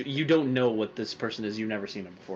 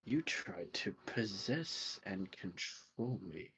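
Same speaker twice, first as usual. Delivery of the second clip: slow hissed threat; menacing whisper; 5 semitones lower, 17 dB quieter, slower than usual. Acted. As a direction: breathe the accusation low and slow. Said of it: "He looks at him and goes".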